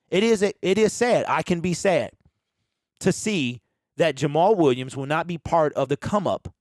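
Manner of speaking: ordinary talk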